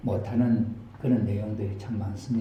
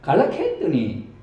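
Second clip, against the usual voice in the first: high Gyeongsang granny explaining, laughing at the end; a country old woman telling the twist; Gyeongsang grandma. Acted. Grandma explains he came back to life after three days, in Gyeongsang dialect. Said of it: "경상도 버전으로".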